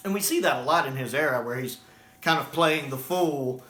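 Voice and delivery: ordinary talk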